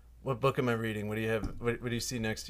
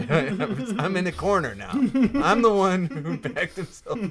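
louder, higher, slow through laughter; laughing; 8 semitones higher, 10 dB louder, slower than usual. Real, not acted. Caught making up stories, laughing confession. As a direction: admit it while cracking up.

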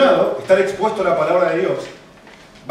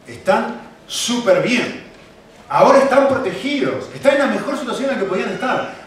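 rising, louder emphatic preaching; 6 semitones higher, 5 dB louder than usual. Real, not acted. Preacher to church, emphatic and louder. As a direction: stress how well off they are. Preacher stressing their good state.

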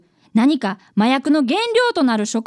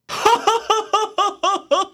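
bursts of hearty laughing from afar; a sudden loud fit of laughter; loud group laughter; 4 semitones higher than usual. Acted. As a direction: strangers suddenly laughing in the square. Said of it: "急に大声で笑い始めたのです".

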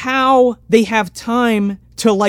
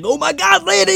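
high-pitched panicked movie quote; hammed-up alarm; mock panic; 11 semitones higher than usual. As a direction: imitate a frantic driver, voice pitched way up. Acted panic over the bump.

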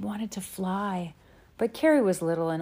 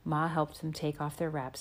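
ordinary delivery

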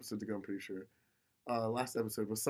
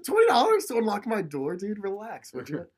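laughing rant; 9 semitones higher, 6 dB louder than usual. Real, not acted. Exasperated rant about Paul charging.

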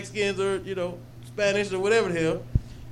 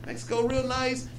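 higher-pitched, casual teasing questions; retold fake small talk, lightly pitched up; mock-innocent baiting; 6 semitones higher than usual. Storyteller baiting his coworker about Mexico, mock-innocent.

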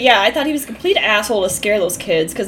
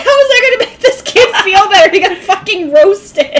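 much higher, louder, laughing through it; laughing outrage; 9 semitones higher, 7 dB louder than usual. Retold or imitated. Friend recalling her reaction to the insult, laughing and much louder. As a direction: shriek it out in amused disbelief. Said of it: "I was like".

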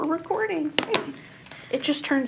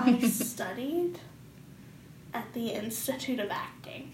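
pretentious actor; 3 semitones lower, 10 dB quieter than usual. Pretend. Mock pretentious actor talk.

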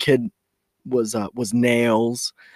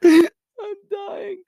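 laughing hard; 6 dB louder than usual. Real, not acted. Laughing at Bubba story.

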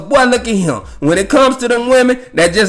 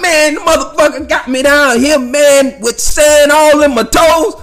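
grumpy complaining voice; 6 semitones higher than usual. Retold or imitated. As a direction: gripe about sand in toes. Grumpy man complaining about sand.

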